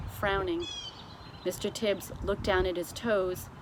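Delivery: usual speaking voice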